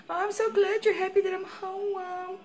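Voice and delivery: very high, sing-song coo at the pet. Real, not acted. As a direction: gush over the dog, lilting high. Cooing to her dog.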